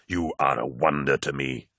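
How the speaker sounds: low, soft admiration; smiling. A narrator's reading. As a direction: marvel gently at your brother. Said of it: "smiled Magnus".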